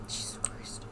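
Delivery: breathless whispered oath; hushed frightened curse; scared whisper. Real, not acted. Scared in horror game.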